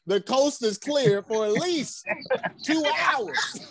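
slow, rising into a laughing shout; punchline through real laughter. Real, not acted. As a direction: land the punchline cracking up. Joke punchline.

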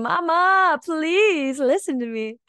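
high, loud cry for Mama; screaming plea. Acted. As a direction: cry out, pleading, stretching the words long. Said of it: "My divine feminine was screaming".

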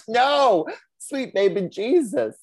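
loud, high-pitched exasperated cry; real embarrassed outburst. Real, not acted. Host botching a company name on air, embarrassed outburst. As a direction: exclaim in mortified disbelief at your own blunder. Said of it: "beat red with embarrassment".

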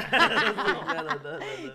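laughing through the words; giggling while trying to talk; can't stop laughing. Real, not acted. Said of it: "no puedo dejar de reír".

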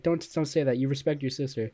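normal talking voice

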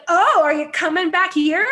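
loud, high mocking question; snide taunt. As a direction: imitate a hostile passenger taunting. Retold taunt from the hostile passenger.

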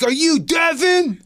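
high, slow shouted question; mock shout. Jealous husband demands Devin, high slow mock shout. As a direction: bark the name at him. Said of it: "He's like".

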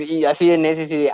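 ordinary talk, nothing marked

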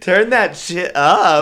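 hyped-up party shout. Pretend. Joking about playing podcast loud.

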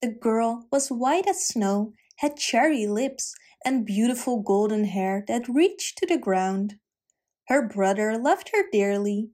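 usual speaking voice

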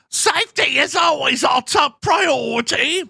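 very high shouted mock British; British accent. Comedian quotes the spokesperson in a shrieking British accent. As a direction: squeal it like a prim British spokesperson. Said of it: "I need to do a British accent".

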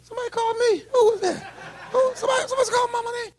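high, fast, flustered voice; hammed-up bewildered churchgoer; mock confused listener. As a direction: play the fool who can't place the voice.

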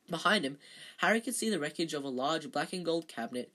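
plain reading voice

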